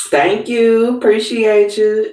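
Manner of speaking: high-pitched, drawn-up thank-you to viewers; grateful shout-out. Real, not acted. Thanking viewers mid-stream.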